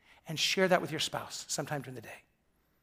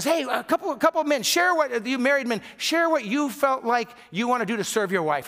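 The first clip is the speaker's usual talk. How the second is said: much higher, calling across the bus; 6 semitones higher, 4 dB louder than usual. Retold or imitated. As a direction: call out to the men on the bus. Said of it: "one of the guys says".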